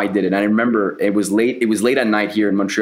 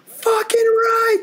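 a high, cracking scream of relief, then a harsh whisper; screaming with joy; much slower than usual. Retold or imitated. Alone late at night after the call, screaming with relief. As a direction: scream it like the deal just saved you. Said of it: "I started screaming".

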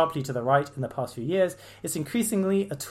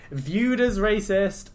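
slow, louder mocking drawl; mock phrase; 7 semitones higher, 7 dB louder, much slower than usual. Vlogger listing bingo phrases, slow sneering mock. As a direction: draw out the stock excuse. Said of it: "playing the kind of racist person bingo".

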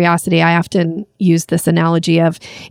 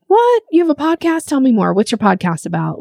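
much higher, delighted what; hooked and eager to hear more; surprised interest; 6 semitones higher than usual. Retold or imitated. Listener hooked by podcast news.

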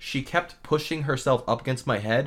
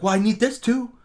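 higher mock-snippy retort; nasty; 9 semitones higher, 3 dB louder than usual. Dentist answers the bickering assistant, nasty retort. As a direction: imitate a testy comeback. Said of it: "they were so nasty to each other".

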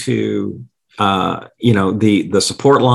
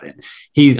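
normal talking voice

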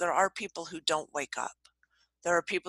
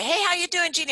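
much higher, bright greeting from the front desk; chirpy secretary; 11 semitones higher, faster than usual. Retold or imitated. Secretary greeting a grumpy coworker, chirpy and high. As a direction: sing it out like a cheery secretary. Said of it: "the secretary would say".

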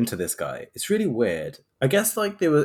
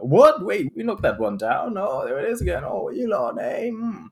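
high-pitched comic dismay; hammed-up bafflement, starting loud; mock exasperated surprise; 9 semitones higher, 4 dB louder than usual. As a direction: play at being startled, then wearily fed up. Acting out playing racist whack-a-mole.

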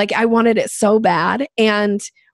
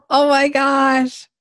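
slow, high shocked exclaim; 6 semitones higher, much slower than usual. Real, not acted. Host's story of the lost deal, slow shocked exclaim. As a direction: let the shock stretch out.

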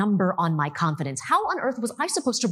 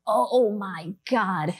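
retold dread; much slower than usual. Retelling dread of being fired.

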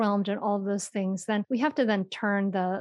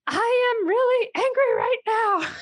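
much higher and louder retold yell; yelled anger; 13 semitones higher, 5 dB louder than usual. Mother reenacts yelling her anger at her daughter, loud yell. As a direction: shout it as you did at the door. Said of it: "but I yelled".

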